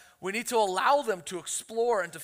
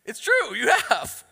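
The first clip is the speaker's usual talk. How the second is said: playful aside; 6 semitones higher, 7 dB louder, slower than usual. Real, not acted. Teasing aside to daughter.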